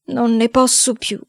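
weary, drawn-out complaint; exhausted despair. Acted. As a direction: sigh it out slowly, worn down. Despairing boy, first take.

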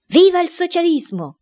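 high, ringing shout of joy. A narrator's reading. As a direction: cry it out as you burst into the room. (grido acuto ed esultante)

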